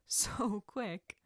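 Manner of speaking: a soft chuckle into the words; amused, laughing lightly as she speaks; quiet laugh. A narrator's reading. Sensei amused by the boy's eager answer, laughing softly. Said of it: "The woman lets out a quiet laugh".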